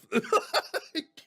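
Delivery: loud, high-pitched laugh; burst of laughter. Real, not acted. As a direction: laugh out loud at your own misfortune. Laughing at his own punchline.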